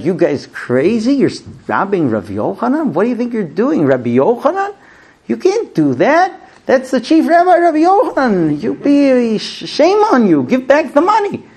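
high-pitched, outraged telling-off in a storyteller's acting; acted outrage, climbing high in pitch; indignant scolding. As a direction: scold the robbers in mounting disbelief.

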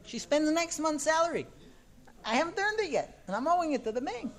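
high-pitched, incredulous mock grievance; exasperated husband, acted. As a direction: act out a husband aghast at the bills. Acted husband exasperated over spending.

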